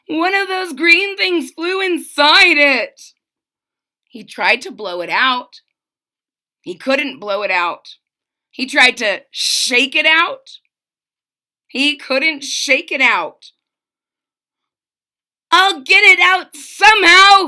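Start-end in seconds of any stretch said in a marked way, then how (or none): 0.1-3.1 gulping dismay. A narrator's reading. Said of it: "he gulped". 15.5-17.5 high loud determined yell. A narrator's reading. Trumpeter yelling he'll free his horn, loud yell. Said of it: "he yelled".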